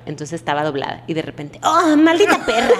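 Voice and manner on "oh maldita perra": slow, high mock dub voice; dubbed cuss line. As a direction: drag out the curse, hammed-up.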